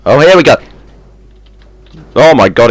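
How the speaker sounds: loud, high, eager; excited. Real, not acted. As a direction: cheer it on excitedly. Safe about to fall.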